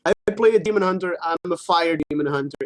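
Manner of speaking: usual speaking voice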